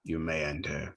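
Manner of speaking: low, softly intoned entity voice; attempted booming voice. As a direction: answer low and quiet as the mysterious transmission. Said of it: "you hear in a booming voice".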